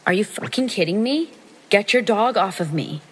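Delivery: re-enacted indignant demand to an attacker's owner; angry exclamation. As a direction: exclaim it in outrage, pitch lifted. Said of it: "Again, I exclaim".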